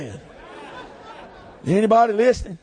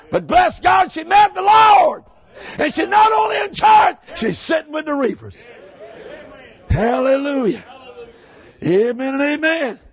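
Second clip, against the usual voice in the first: fiery preaching; 5 semitones higher than usual. Acted. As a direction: preach it at full force to the congregation. Preaching at full force.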